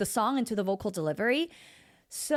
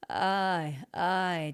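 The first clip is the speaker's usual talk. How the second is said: down low; 4 semitones lower than usual. Shown as a demonstration. Coach recalling how the song started, down low. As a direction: lower held vowel. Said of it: "down there, how we started out".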